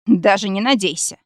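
a lightly voiced growl of refusal. A narrator's reading. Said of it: "зарычал шеф". (слегка рычащий отказ шефа)

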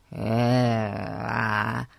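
grunting through glued jaws; barely opening the mouth; mouth stuck shut. Acted. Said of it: "делая усилие раскрыть рот".

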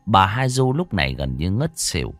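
ordinary delivery

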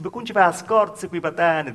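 loud, mocking jab through a laugh; loud teasing. [name] teasing the girl out loud. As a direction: call it out loud to tease her.

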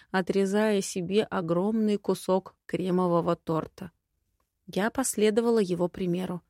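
ordinary delivery, nothing marked

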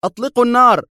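high frantic yell of an order; agitated shout. A narrator's reading. Commander over loudspeakers screaming at his men, frantic shout. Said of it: "وصاح عبر مكبرات الصوت في انفعال واهتياج".

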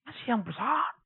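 normal talking voice

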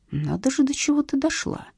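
soft, incredulous reproach; understated scandalized husband; mild exclamation. A narrator's reading. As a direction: marvel disapprovingly, keeping it quiet. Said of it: "воскликнул муж".

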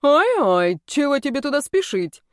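high, fussy clucking jibe; tut-tutting like a hen; clucking taunt. A narrator's reading. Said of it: "прокудахтал этот тип".

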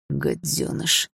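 father hissing at his defiant son; hissed insult. Acted. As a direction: spit it out under the breath. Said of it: "прошипел старик".

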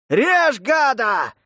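loud, high sailor's battle cry; shout. A narrator's reading. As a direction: yell the attack order. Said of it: "раздался крик матроса".

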